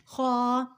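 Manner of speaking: خا as heavy kh with rounded "aw"; thick pronunciation. Shown as a demonstration. Teacher repeating for learners, خا thick and held. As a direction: make خا full and thick, held level. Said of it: "موٹا پڑھا جائے گا".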